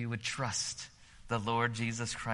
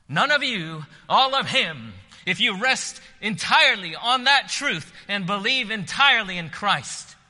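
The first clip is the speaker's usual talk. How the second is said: preaching at full force; 9 semitones higher, 12 dB louder than usual. Acted. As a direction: lift the whole room with the invitation.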